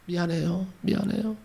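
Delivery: a daughter begging forgiveness through tears; tearful apology. Acted. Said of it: "울면서 용서를 빌었습니다". (울먹이며 용서를 비는 목소리)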